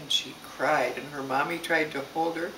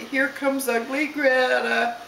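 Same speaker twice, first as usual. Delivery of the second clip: lightly voiced schoolyard taunt, drawn out and higher; teasing kids; 6 semitones higher, slower than usual. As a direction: chant it slowly like kids teasing, lightly acted. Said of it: "the kids all say".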